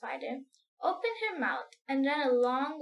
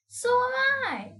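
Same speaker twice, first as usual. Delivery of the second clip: higher, louder eager cry; ladybird crying out; 11 semitones higher, 7 dB louder, slower than usual. Acted. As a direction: chime in eagerly, pitched up. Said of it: "the ladybird cried".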